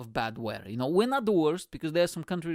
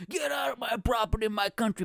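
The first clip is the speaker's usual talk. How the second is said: high-pitched, hammed-up border guard; mock territorial yell; 8 semitones higher than usual. Pretend guard warning people off at the border, mock yelling. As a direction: pretend to chase intruders off, pitched way up.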